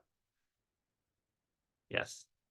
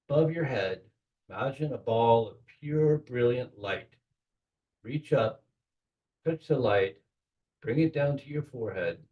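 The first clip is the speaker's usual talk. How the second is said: guided meditation. Acted. Guiding the group through the Kabbalistic cross, in a calm meditation voice. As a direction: lead the listeners gently into the ritual.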